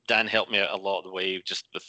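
ordinary delivery

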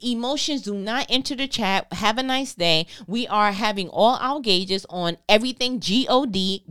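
ordinary talk, nothing marked

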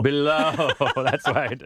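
laughing through the words. Real, not acted. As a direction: chuckle while agreeing. Laughing at the correction.

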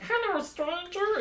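slow, soft falsetto mimicry; high-pitched imitation. Podcaster imagining the sci-fi Daft Punk songs, high-pitched mimicry. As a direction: imitate a high, drawn-out song hook softly. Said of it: "gonna be high pitched".

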